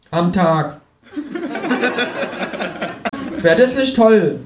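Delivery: high, pert kangaroo voice lecturing; sassy kangaroo holding forth; cheeky kangaroo. Acted. Kangaroo mocking the economist on live TV, high sassy voice.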